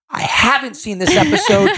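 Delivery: high-pitched mock yell. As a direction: insist indignantly, re-enacting your own outburst. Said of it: "no reason to raise your voice".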